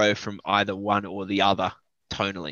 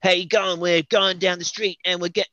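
higher, louder mock broad Australian accent; 9 semitones higher, 5 dB louder than usual. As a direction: send up an Aussie hip hop vocalist in a hammed-up Australian accent. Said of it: "because they sound Australian".

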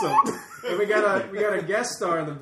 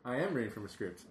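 deadpan admission; 5 semitones lower, 9 dB quieter than usual. Real, not acted. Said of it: "you sound like you're reading".

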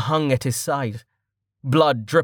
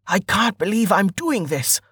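high, strained exclamation; tense disbelief; 8 semitones higher than usual. A narrator's reading. Archer aiming at a bandit, tense disbelief. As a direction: blurt it higher, incredulous at yourself. Hero takes aim in disbelief.

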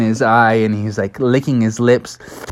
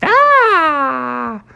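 put-on baby creature noise; 12 semitones higher than usual. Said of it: "He just said".

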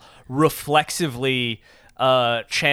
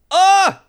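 high, loud "Ah!"; excited yelp; 9 dB louder than usual. Real, not acted. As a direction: let out a sudden high cry of excitement. Excited cry at a good dice roll.

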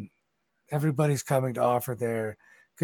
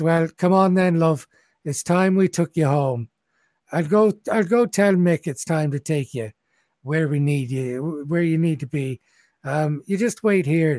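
Daisy's voice; 5 semitones higher, 5 dB louder than usual. Acted. Daisy comforting drunk crying Willa.

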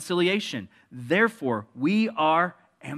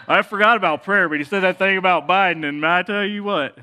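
louder, higher Southern churchgoer voice; Southern accent; 4 semitones higher, 6 dB louder than usual. Pretend. Pastor mimicking a churchgoer gossiping in a Southern accent. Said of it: "And we all became Southern".

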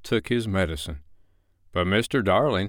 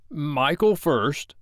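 slow, higher, dogged insistence; 6 semitones higher, much slower than usual. A narrator's reading. Father making Michael go first, doggedly. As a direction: dig in stubbornly on each word. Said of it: "he said doggedly".

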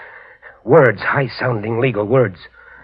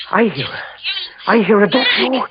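much higher and louder, building in panic; frantic rising agitation; 9 semitones higher, 3 dB louder than usual. Acted. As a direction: spiral into hysteria. Prisoner relives wife's murder.

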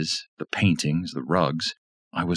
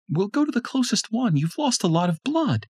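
higher woman's voice, fast at the end; urgent Tanya. A narrator's reading. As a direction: hurry him along firmly. Tanya hurries him.

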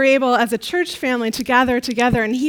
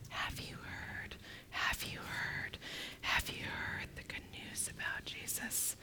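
very quiet whisper; 22 dB quieter than usual. Acted. Mary telling people her friend is dead, whispered. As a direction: whisper it like a secret. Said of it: "in a very quiet voice".

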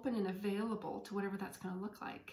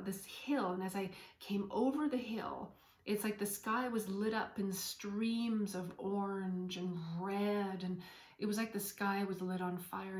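ordinary talk

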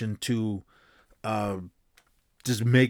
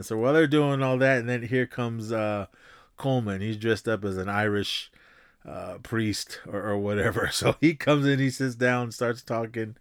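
ordinary delivery

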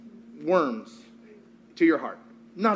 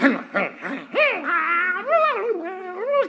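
high manic gibberish noise, drawn out; crazy passenger noises; 11 semitones higher than usual. Retold or imitated. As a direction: imitate a crazy passenger's wild babble. Imitates the crazy bus passenger.

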